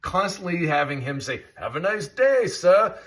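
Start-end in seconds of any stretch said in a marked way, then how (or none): 1.6-2.9 mock English accent, a bit higher; lightly faked English accent for a polite cab driver; a mild English accent parody of the driver; bad English accent. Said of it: "you think that's a bad English accent".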